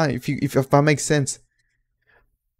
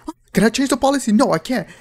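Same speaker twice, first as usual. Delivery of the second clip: higher, fast, indignant griping; mock griping coworkers; 9 semitones higher, 4 dB louder, faster than usual. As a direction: fire off exasperated questions, pitched up. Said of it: "this is them talking".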